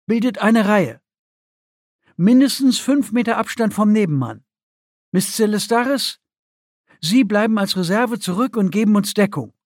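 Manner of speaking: commander giving orders. A narrator's reading. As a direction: issue orders to the squad. Commander orders the team into line.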